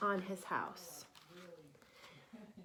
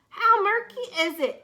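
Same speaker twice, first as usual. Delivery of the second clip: complaining; 9 semitones higher, 9 dB louder than usual. Acted. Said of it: "complained the coral".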